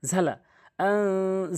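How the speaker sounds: ঝালা, আং with আং held nasally; slow Quran recitation; slow, drawn-out ghunna. Shown as a demonstration. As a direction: stretch আং long through the nose. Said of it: "গুণনা করবেন".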